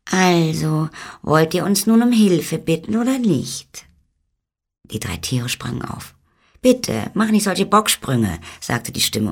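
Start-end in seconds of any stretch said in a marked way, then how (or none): 0.1-3.8 high-pitched, pestering tiny fairy-folk voice; impatient little man. Acted. Unseen little man offers help to three animals, high and impatient. 6.6-8.4 high, bossy tiny-man voice; scolding little man. Acted. Little man tells jumping animals to stop, high and scolding.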